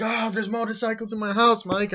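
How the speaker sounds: high, loud put-on cry of dismay; hammed-up high-pitched complaint; retold alarmed wail. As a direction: wail it way up high, imitating a panicked character. Acted panicked complaint from film.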